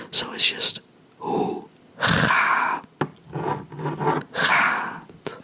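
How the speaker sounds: "gaat" slowly with a throaty G, long A and a clear T; slow whispered model of Dutch "gaat"; slow Dutch demo. Shown as a demonstration. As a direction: stretch out "hoe ga, gaat", sounding every part. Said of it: "Don't forget to pronounce the T".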